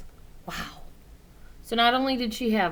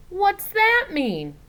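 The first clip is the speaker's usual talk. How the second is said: put-on puzzled child; 11 semitones higher, 5 dB louder, slower than usual. Child asks again.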